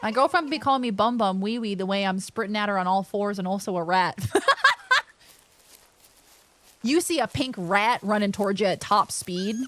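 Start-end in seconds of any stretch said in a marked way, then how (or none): 4.2-5.0 high-pitched burst of laughter. Real, not acted. Player laughing at being a sprinting rat, high laugh.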